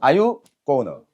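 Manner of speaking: usual speaking voice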